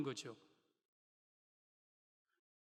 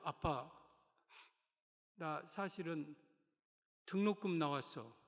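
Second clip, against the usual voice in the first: sad confession; slower than usual. Acted. Son confessing tearfully.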